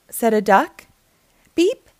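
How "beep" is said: high little beep; friendly small-truck toot; short beep, pitched up; truck horn beep. A narrator's reading. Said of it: "said the friendly little blue truck".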